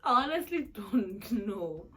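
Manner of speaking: a real, slow teary giggle of not knowing; half-laughing, half-crying. Real, not acted. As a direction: laugh helplessly through the words. Overwhelmed by marriage question.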